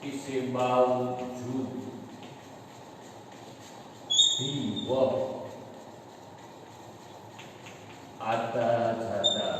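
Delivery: slow wrong example. Shown as a demonstration. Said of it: "ये गलत वाक्य है।".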